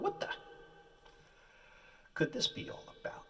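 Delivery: breathy inner wondering; whispered thought. Retold or imitated. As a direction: whisper it to yourself.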